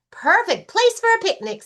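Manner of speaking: very high, loud eager bunny voice; excited Rabbit. Acted. Rabbit offering a picnic to Lion, very high and loud. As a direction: squeal it out happily.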